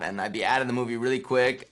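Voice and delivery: usual speaking voice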